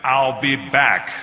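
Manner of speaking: slow, loud catchphrase delivery; weighty action-hero one-liner; Terminator. Acted. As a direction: deliver it slowly and forcefully, like a famous movie line. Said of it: "when I did Terminator".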